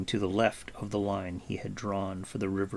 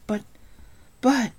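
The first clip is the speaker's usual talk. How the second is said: high, halting protest; stammering; 4 dB louder than usual. A narrator's reading. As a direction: stumble on the word in disbelief. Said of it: "Taran stammered".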